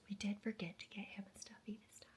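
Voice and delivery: hushed whisper so the dog won't hear. Real, not acted. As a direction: whisper it behind the dog's back. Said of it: "I'm gonna cover my dog's ears".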